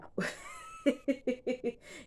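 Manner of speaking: a high squeaky burst of real laughter. Real, not acted. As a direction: let a sudden high laugh slip out. Laughs at own lateness.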